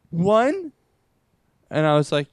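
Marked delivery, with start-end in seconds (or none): 0.1-0.7 shocked question. Retold or imitated. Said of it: "She's like".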